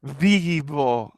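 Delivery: vivo with a strong buzzing English V, lip on teeth; exaggerated V sound. Shown as a demonstration. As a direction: overdo the V in vivo on purpose. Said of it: "I'm exaggerating a little bit".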